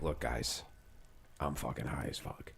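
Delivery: hushed, low confession to the table; leaning in confidingly. Acted. Imagined waitress levelling with her customers, hushed and confiding. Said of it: "leaned in and was like".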